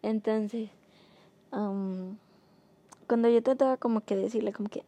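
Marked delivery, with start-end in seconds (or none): none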